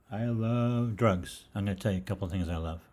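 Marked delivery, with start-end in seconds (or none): 0.1-1.4 slow, emphatic repeat. Shown as a demonstration. Host repeating a provocative line to listeners, slow and emphatic. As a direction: drag out I love drugs for emphasis.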